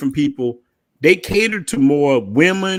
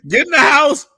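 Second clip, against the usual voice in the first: laughing mock warning; 8 semitones higher than usual.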